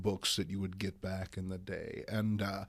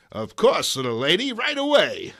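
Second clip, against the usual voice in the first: louder, gentlemanly helpful man; gallant stranger; 7 semitones higher, 12 dB louder than usual. Acted. Stranger helping Aunt May with change, loud gallant gentleman. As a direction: answer like an obliging gent. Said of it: "passing stranger".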